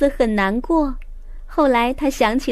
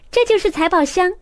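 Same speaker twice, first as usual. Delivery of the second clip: high, excited discovery shout; 7 semitones higher, 3 dB louder than usual. A narrator's reading. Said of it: "路易斯喊道".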